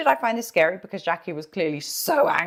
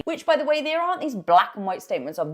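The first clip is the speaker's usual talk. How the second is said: ordinary delivery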